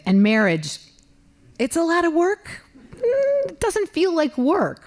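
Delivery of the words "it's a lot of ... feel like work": high, sing-song aside; mock sweet high voice. Wife teasing the audience about marriage, sing-song high. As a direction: lilt it up sweetly.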